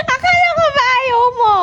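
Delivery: high, squealing mock reproach; delighted teasing. Real, not acted. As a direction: tease in a high voice fresh from laughing. Teasing child who changed her mind. (matinis na panunukso)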